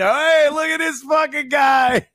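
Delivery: high, shouted mock heckle; jeering call-out. Public pointing at him after his arrest, jeering shout.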